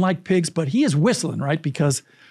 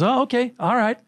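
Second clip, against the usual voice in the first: mechanic Jed's reply; 7 semitones higher than usual. Retold or imitated. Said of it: "And Jed goes".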